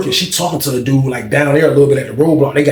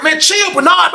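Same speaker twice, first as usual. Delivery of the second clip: high yell at a friend; urgent shouted plea to stop fighting; retold yell; 12 semitones higher, slower than usual. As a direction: call out over the noise to stop. Yelling through door at fight.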